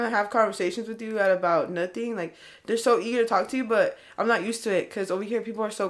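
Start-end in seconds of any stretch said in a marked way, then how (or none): none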